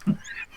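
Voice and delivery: staccato ha-ha-ha laugh; chuckling laugh. Real, not acted. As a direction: laugh in separate, even bursts. Amused by crocodile shoes remark.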